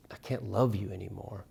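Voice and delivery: low, soft line with gentle weight on "love"; soft emphasis on "love"; stress on LOVE. Shown as a demonstration. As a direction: quietly lean on "love". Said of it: "Emphasize individual words in the title".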